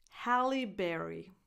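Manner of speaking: ordinary talk, nothing marked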